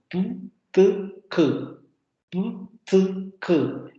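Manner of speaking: "Pu, tu, ku" crisply; "Pu, tu, ku" as clear bursts; demoed stop series. Shown as a demonstration. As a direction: tap each plosive. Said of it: "hacen el ejercicio de pronunciarlas".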